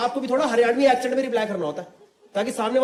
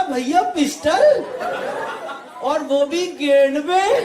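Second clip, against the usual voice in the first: high, slow, drawn-out disbelief; mock incredulous; 4 semitones higher, much slower than usual. Driver questioning the gunman's odd threat, mock incredulous. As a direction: stretch each phrase into a bewildered question. Acting his stunned reply to threat.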